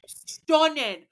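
high, hammed-up British accent; exaggerated British foodie; mock British accent. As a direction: savour it in a silly British voice. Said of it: "You can talk British".

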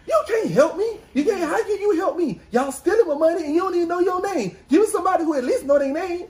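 high, fast mock rant of an angry caller; angry customer. Retelling a call, mimicking the angry customer, high and fast.

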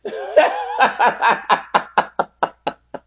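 high hooting cackle; loud cackle. Real, not acted. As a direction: burst into a loud high laugh. Big laugh at own twist.

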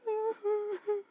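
high mock sob for a story rabbit; a short hammed-up cry for the children. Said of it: "Mister Rabbit started to cry".

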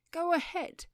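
high, loud challenge; defiant dare. Acted. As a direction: dare them to do it. Man defies a gun threat.

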